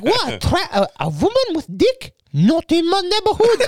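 put-on very high outraged mum, botched Portuguese accent; mock-scandalised mother with Portuguese accent attempt; bad Portuguese accent. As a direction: ham up a wrong Portuguese accent. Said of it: "all these accents are wrong".

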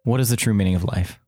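quick, clipped re-ask; fast impatient repeat. Real, not acted. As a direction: rattle it off again, impatient. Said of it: "I asked the fucking question already".

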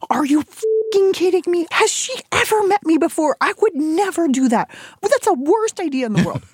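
exploding in disbelief at the agent's idea; mock outrage. Imitating herself ranting at her agent, high and outraged. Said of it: "I lost my sh".

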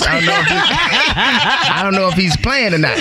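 breaking into laughter mid-quote; can't finish without cracking up; laughing. Retold or imitated. Retold own reaction, breaks laughing.

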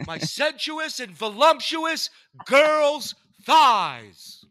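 very high, loud, slow, sleazy voice; imitated radio drop. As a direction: croon it like a creepy radio drop. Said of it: "favorite drops of his".

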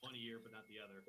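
faint, broken-up call audio; audio cutting out. Real, not acted. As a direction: voice dropping out on the line. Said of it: "you cut in and out".

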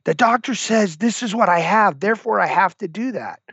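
imitating the inner whiner complaining about his limits; whiny inner voice. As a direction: whine like the voice that gives up. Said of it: "the whiner's going".

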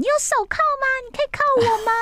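very high, teasing squeal; flirty fangirl. Retold or imitated. Xiao S teasing the husband over the phone, very high flirty squeal. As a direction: flirt outrageously, all in character. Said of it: "他就非常的入戏".

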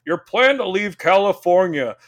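mock dire stepdad warning; alarmed stepdad warning. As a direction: sound the doomsday alarm. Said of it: "he's like".

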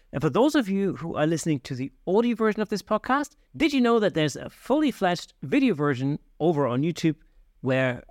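host wrapping up the episode in a bright outro voice; podcast outro read. Acted. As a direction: sign off the show like a radio host.

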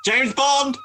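excited heckler imitation; imitating a yelling fan. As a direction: mimic the nerd yelling from the back row. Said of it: "fat guy at the back yelling".

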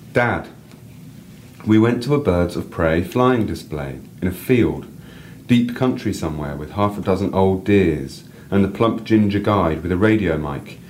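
reading a personal story aloud, lively. A narrator's reading. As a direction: read your piece to the listener with feeling. Reads own story aloud.

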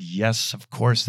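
usual speaking voice

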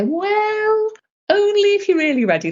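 high, singsong and playful; teasing high voice. Retold or imitated. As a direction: tease them high and light. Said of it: "And I say".

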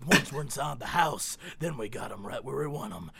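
menacing low quote of Bubba; lightly voiced creepy Bubba; sinister Bubba. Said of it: "that's a literal line from the thing".

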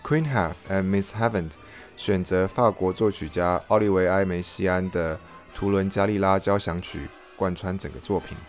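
usual speaking voice

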